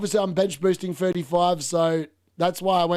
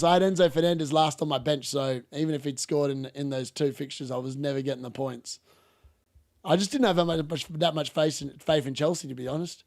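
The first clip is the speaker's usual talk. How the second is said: ordinary talk, nothing marked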